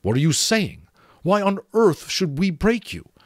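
high, loud, incredulous demand; baffled, forceful questioning. A narrator's reading. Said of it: "Smendrick demanded".

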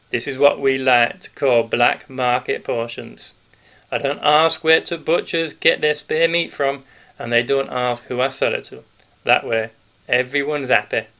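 put-on a big grocer letting the children in on a secret; hearty Yorkshire grocer. Grocer voiced through the scene.

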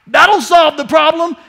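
loud, high, slowed mock cry; mocking Israelites shouting for the ark; people's demand. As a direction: ham up a frantic crowd. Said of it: "It's people saying".

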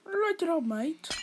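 British accent demo. Shown as a demonstration. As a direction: show how a Brit says "Light it up, mate". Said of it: "you do it then".

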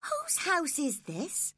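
put-on high girlish voice, pleading; a young girl defending herself, pitched high; spoilt young girl. Girl pleading with her nurse, high girlish voice.

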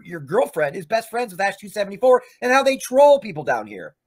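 ordinary delivery

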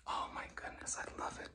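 hushed delight; soft admiring whisper. Real, not acted.

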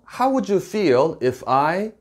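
slow, clearly spaced English; teacher modelling each word slowly; slow clear model phrase. Shown as a demonstration. English teacher modelling the phrase slowly for learners. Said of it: "이렇게 연결을 하시는 거예요".

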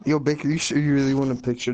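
ordinary talk, nothing marked

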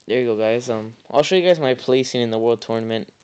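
ordinary talk, nothing marked

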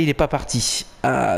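ordinary delivery

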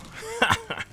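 high-pitched giggle. Real, not acted. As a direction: giggle at the joke. Laughs at own trick.

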